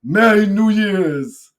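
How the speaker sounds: loud, drawn-out fake accent; hammed-up movie accent, high and slow; Eddie Murphy. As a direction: crow it in a put-on foreign accent. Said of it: "Eddie Murphy pretending to have a fucking accent".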